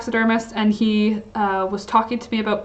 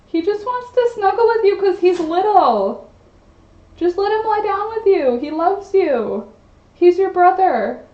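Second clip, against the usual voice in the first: higher coaxing voice for the pets; coaxing pet talk; 9 semitones higher than usual. Real, not acted. Owner coaxing the little pet to snuggle, in a higher coaxing voice. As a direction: coo at the pet so it will cuddle up.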